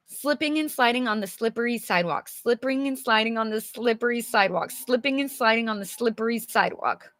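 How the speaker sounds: fast tongue-twister run, each word still clear; three times fast. Shown as a demonstration. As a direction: rattle off Slipping and sliding on the slippery sidewalk quickly. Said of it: "Three times fast".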